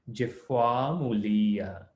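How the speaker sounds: slow, drawn-out "Jifwa mulia"; careful Sanskrit. Shown as a demonstration. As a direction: say "Jifwa mulia" slowly, rolling the f. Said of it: "rolls off your tongue".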